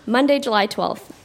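ordinary talk

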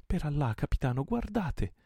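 high, loud, urgent cry; exclamation. A narrator's reading. Pirate urges his captain to look, high excited exclamation. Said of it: "esclamò egli".

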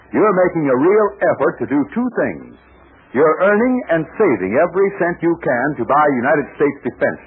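upbeat radio commercial pitch; ad read. Acted. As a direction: sell it warmly to the kids. Sponsor ad read for children.

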